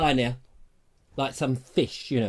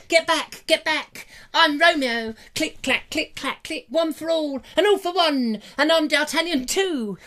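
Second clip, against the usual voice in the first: swashbuckling Romeo; 10 semitones higher than usual. Acted.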